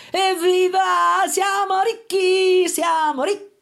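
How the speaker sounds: loud, high, drawn-out sing-song cheering; joyful cheer. A narrator's reading. Mother and son finding gold, loud joyful chant. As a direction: celebrate the gold. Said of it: "esclamarono in coro".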